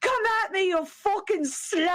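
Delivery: high-pitched mock English cursing; English accent. Player imitating an English teammate attacking a goblin, English accent. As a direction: hurl it in an exaggerated English accent. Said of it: "like that in the English accent".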